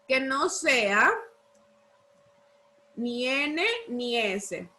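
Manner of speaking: measured chunks for note-takers; dictating. Real, not acted. Teacher dictates rule in chunks.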